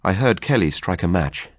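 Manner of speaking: plain reading voice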